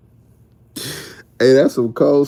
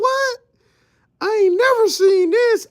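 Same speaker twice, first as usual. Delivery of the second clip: high, squeaky "what?" of disbelief; mock shocked disbelief. Reacting to a teammate's clip, high shocked disbelief. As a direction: act floored, like you never saw it. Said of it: "I was like".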